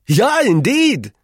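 very high, loud and drawn-out; echoing wife. A narrator's reading. Wife echoes her husband, high and emphatic. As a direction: echo him in a high, slow wife's voice. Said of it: "echoed his wife".

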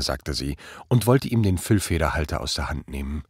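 ordinary delivery, nothing marked

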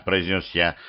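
plain reading voice

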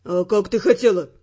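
low male voice, heating up; growled. Acted. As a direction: growl it back, getting angrier. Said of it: "распаляясь, прорычал Феррант".

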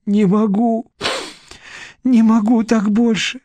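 high-pitched, despairing moan; muffled wail. A narrator's reading. Vera on the floor, unable to bear it, wailing. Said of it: "Глухо зазвучал её голос".

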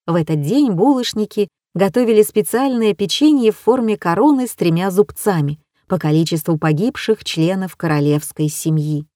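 plain reading voice

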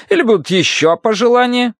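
high, loud sarcastic query; testy Bible reader. Acted. Woman asking patient for further requests, testy high voice. Mrs. Gadson asks testily.

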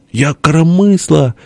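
high, slow character wail; plaintive boast. A narrator's reading. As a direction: draw the claim out high. Перепалка: похвальба и возражение. (высоко и протяжно, настаивая)

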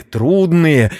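plain reading voice

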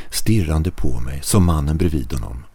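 usual speaking voice

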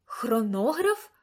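slow, questioning Хронограф rising at the end; puzzled echo. A narrator's reading. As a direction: echo Хронограф back, unsure. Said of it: "відлунила я".

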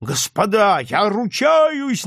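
high, drawn-out plea over uproar; straining shout. A narrator's reading. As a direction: strain to be heard above a crowd. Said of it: "надсаживаясь, закричал старшина".

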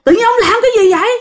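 high-pitched, frantic crowd protest; alarmed villagers' outcry. Acted. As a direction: cry out in alarm as horrified onlookers. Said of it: "mọi người la quá trời".

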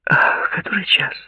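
high, startled question; surprised. A narrator's reading. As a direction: ask in sudden surprise. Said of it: "спросила удивлённая Клаудия".

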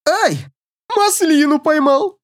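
high, silly yelp of a goofball; goofy voice. Acted. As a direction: squeal it like a clown caught a bullet. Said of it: "придурочный голос Деймоса".

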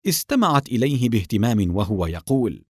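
plain reading voice

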